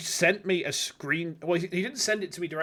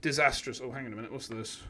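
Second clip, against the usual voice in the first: ordinary talk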